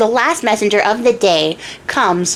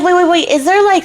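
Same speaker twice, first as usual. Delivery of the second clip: high, hurried plea to wait; lightly acted alarm; mock panicked interruption; 8 semitones higher than usual. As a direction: cut in high as the worried queen. Queen panics at the deal.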